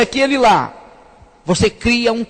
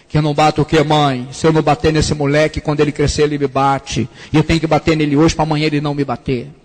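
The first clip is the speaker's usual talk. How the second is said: deepened voice; 7 semitones lower than usual. Retold or imitated. Young son deepens his voice to answer his mother, low and gruff. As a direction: voice pushed lower, gruff young man. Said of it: "Eu engrossei a voz".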